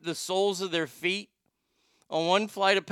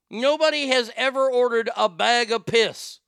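loud, raised-pitch declaration; indignant rant; 5 semitones higher, 6 dB louder than usual. Acted. Host insists nobody would order such a thing, loud indignation. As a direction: drive it home loudly, higher than usual.

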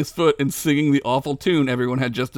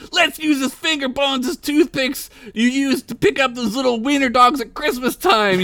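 higher, otherworldly druid voice; druid yelling; 10 semitones higher than usual. Acted. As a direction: druid suggesting finger-bone toothpicks, odd high voice. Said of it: "yelled a druid".

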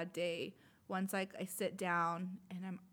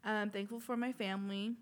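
ordinary talk, nothing marked

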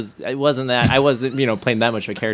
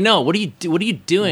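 pitched-up mock confrontation, higher voice; imitating angry friend; 6 semitones higher, faster than usual. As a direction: demand an answer like an accusing buddy. Friend confronting him on phone.

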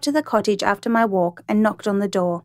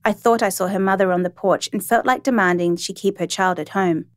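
ordinary delivery

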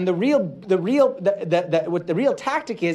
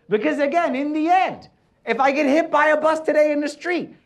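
high-pitched, driving sermon delivery; emphatic preaching; 8 semitones higher, 4 dB louder than usual. Real, not acted. Preacher telling the congregation forgiveness is a requirement, emphatic preaching. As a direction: preach it hard, pitch lifted right up.

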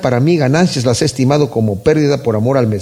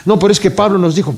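mock objector; 3 semitones higher, faster than usual. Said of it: "Si alguien dice".

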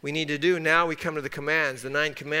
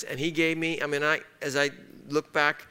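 usual speaking voice

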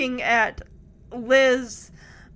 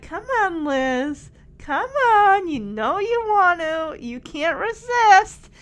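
high, loud playful wheedling; teasing coaxing; 6 semitones higher, 6 dB louder, slower than usual. Retold or imitated. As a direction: lure her in, playfully insistent. Kids coaxing hesitant Liz, retold.

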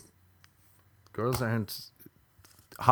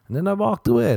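high excited punch; 10 semitones higher, 9 dB louder, faster than usual. Real, not acted. Laughing punchline.

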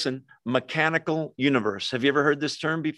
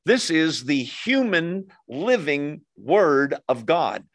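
ordinary delivery

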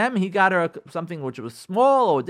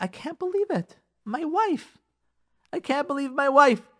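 mock disbelief; 8 semitones higher than usual. Husband's outraged reaction at the cleaners.

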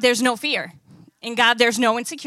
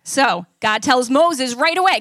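usual speaking voice

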